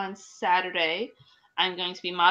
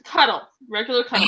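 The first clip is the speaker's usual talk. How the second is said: louder, insisting on cuddle; 7 dB louder than usual. Retold or imitated. Host clearing up that she only meant cuddling, firmer louder insisting. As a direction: set the record straight, firmly. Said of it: "I know where your mind's going".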